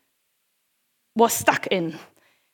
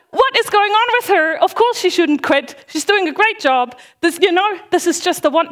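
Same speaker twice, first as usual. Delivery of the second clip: playing the puzzled congregation, pitched up; incredulous listener; 6 semitones higher than usual. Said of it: "And you're sitting here going".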